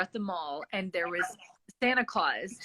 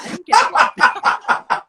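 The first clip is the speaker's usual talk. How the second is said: loud laughing through the words; 9 semitones higher, 12 dB louder than usual. Real, not acted. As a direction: crack up while saying it. Laughing at own story.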